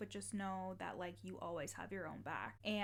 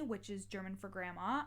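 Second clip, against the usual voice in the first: ordinary talk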